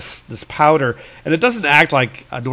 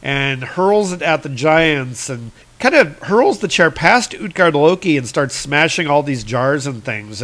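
ordinary talk, nothing marked

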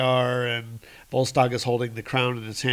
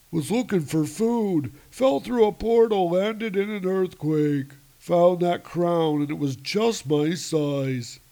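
meek giant; 6 semitones higher than usual. Acted. Giant explaining meekly.

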